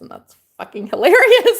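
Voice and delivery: breaking into a laugh. Retold or imitated. Joking with the neighbor about pinkies, laughing. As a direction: laugh through the line.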